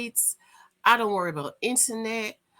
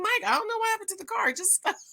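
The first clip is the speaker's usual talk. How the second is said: high, fast panicked phone call; high flustered rush; mock panic; 13 semitones higher, faster than usual. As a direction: blurt it out in a fluster.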